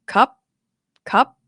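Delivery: "cup" short, high and abruptly stopped; high-tone dead syllable. Shown as a demonstration. Teacher shows the easier high note, short and stopped. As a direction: pop "cup" up and cut it off. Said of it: "when you use a dead syllable".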